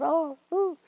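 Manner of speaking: swooning, high mock-overwhelmed "oh, ooh"; imagined tearful gasp. Groom imagining his girlfriend overcome at the proposal, mock-tearful. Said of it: "tears everywhere".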